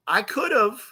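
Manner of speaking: high, lilting mock admission; teasing concession. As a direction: lift it up high, playfully conceding. Playful comeback to mad friend.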